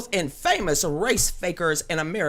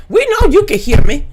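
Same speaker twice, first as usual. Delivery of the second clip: much louder, slightly raised pitch; loud call-out; 5 semitones higher, 16 dB louder than usual. Real, not acted. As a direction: shout it so nobody can miss it. Calling out during sound check.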